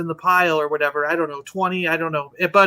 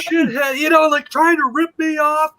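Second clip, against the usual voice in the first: raised, high-pitched angry rant; going nuts; 9 semitones higher, 3 dB louder than usual. Retold or imitated. Toy dealer accusing a haggling customer, going nuts. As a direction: imitate a seller blowing up, pitch way up. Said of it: "went nuts".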